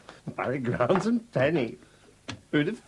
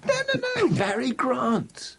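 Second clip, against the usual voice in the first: gleeful gossip; 14 semitones higher, slower than usual. Acted.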